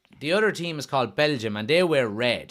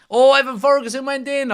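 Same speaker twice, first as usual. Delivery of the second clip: high, loud mock goal call; excited commentary; 12 semitones higher, 7 dB louder than usual. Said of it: "mock commentary quote of a goal".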